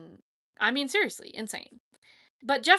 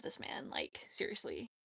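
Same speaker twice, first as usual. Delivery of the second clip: whispered aside; 13 dB quieter than usual. Real, not acted. Emphatic aside about loving Waltrip.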